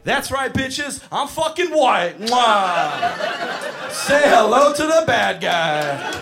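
loud, slow, cocky villain taunt; wrestling heel addressing the crowd, cocky and loud; heel wrestler. Pretend. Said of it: "pretend to be a villain and a bad guy wrestler".

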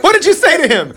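high-pitched mock outraged demand; alarmed wife. Wife confronting husband at her dad's deathbed, mock alarmed. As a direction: imitate an appalled wife, voice shooting way up.

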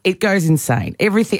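normal talking voice

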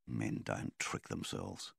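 slow, low, soft self-talk; telling himself. A narrator's reading. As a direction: murmur it slowly to yourself as a firm reminder. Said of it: "he told himself".

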